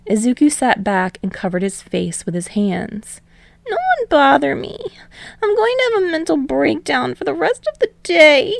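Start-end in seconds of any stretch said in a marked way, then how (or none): 3.7-8.5 high, fast melodramatic whine; a boy declaring his breakdown; dramatic despair. Acted. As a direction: Izuku groaning to friends he's done, high and fast. Izuku voiced high.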